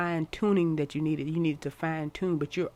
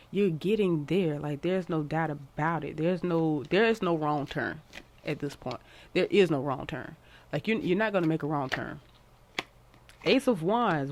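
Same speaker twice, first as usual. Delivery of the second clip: ordinary talk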